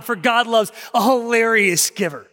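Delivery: ordinary talk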